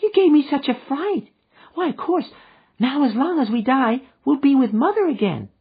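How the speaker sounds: put-on high, loud childlike relief; a small girl glad to join her mother; gleeful little girl. A narrator's reading. Little girl relieved, answering the man gleefully. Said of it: "laughing gleefully".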